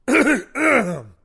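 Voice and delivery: very loud, exaggerated throat clear. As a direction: clear your throat hard, for attention. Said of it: "Let me clear my throat again".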